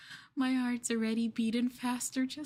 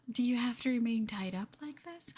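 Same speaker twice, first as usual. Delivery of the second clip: normal talking voice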